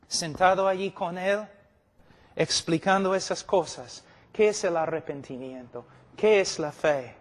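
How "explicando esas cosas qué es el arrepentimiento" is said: usual speaking voice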